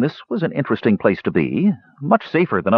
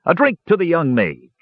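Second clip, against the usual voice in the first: lightly voiced dwarf raising a mug, higher; a hearty toast, raised higher; a hint of a dwarf host toasting the mage; dwarf's toast; 8 semitones higher than usual.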